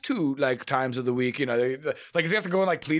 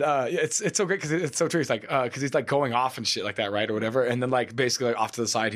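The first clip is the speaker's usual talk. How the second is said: normal talking voice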